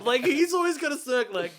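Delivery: ordinary talk, nothing marked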